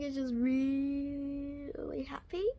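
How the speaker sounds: slow, whispered through happy tears; happy crying. Real, not acted. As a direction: weep with joy while speaking. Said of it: "I'm sorry if I start crying".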